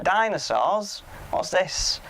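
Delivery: a hammed-up puzzled "what's this"; mock baffled officer. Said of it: "He's like".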